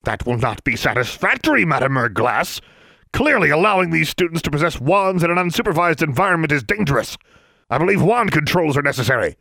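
high, loud, officious inspector; pompous official. Acted. Inspector Brown rebukes the headmistress for laxity, pompous and high. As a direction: lecture her like a disappointed bureaucrat. Brown demands wand controls.

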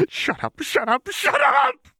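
high, frantic screaming, slowing down; frantic shout. Acted. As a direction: scream it to drown out the voices. Jinx panicking at voices.

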